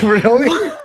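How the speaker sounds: high, loud incredulous "Really?"; a sharp, high disbelieving question; mock disbelief. Reacting to the ginger guy's claim, high incredulous disbelief.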